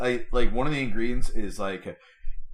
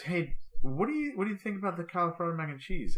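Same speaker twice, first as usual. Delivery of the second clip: lightly imitated wife, pitched up and softer; higher, quieter voice for the quoted wife; wife's question; 8 semitones higher, 3 dB quieter than usual. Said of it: "So she goes".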